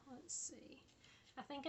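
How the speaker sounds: whispered to herself. Real, not acted. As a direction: breathe it out under your breath. Whispering to herself while crafting.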